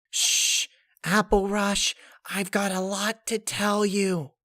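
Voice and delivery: high pony voice opening on a whispered shush; bubbly pony turning secretive; Pinkie Pie hushing. Acted. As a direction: hush her, then share a secret. Pinkie hushes her, secretive.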